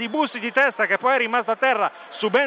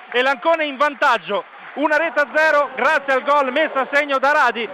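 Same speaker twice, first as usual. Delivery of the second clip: high, loud goal call; breathless italian goal commentary; 6 semitones higher, 4 dB louder than usual. Real, not acted. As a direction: shout the goal, then ride it. (telecronaca del gol, urlata e senza fiato)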